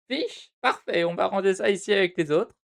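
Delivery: high, hammed-up receptionist voice, horribly overdone. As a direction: play the receptionist as a sugary falsetto clerk. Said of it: "je vais arrêter de faire les voix, hein, c'est vraiment horrible".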